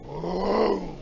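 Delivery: long hollow "whoo"; engine whoosh. Retold or imitated. Imitating the plane's approach, drawn-out whoosh.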